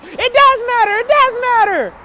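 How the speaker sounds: high, loud mock yell, repeated; hammed-up shouted insistence; mock screaming. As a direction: imitate his tantrum shouting. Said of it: "watching him scream and shout".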